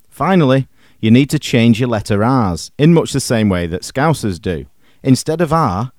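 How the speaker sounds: ordinary talk, nothing marked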